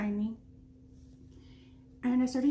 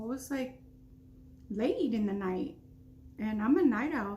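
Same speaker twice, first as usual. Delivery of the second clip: usual speaking voice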